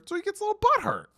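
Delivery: squeaky, fast mock-baby whine; high and quick like teasing a toddler; mocking whiny voice. Vlogger mocking a sulking receiver in a whiny voice. Mocking a player's sulky reaction.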